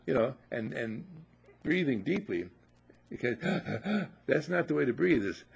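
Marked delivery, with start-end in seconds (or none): 3.4-4.1 demo gasp. Shown as a demonstration. Shows bad breathing.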